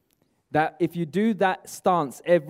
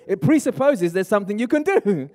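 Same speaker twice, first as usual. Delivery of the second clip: usual speaking voice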